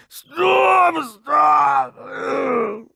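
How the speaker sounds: high, loud pained scream. A narrator's reading. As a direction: scream in agony to stop him. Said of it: "He screams".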